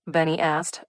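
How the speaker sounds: ordinary delivery, nothing marked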